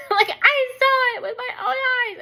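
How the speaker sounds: high, loud mock wail; frantic insistence. Wife swearing to husband she saw it, hysterical wail. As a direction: shout it, half laughing, half wailing.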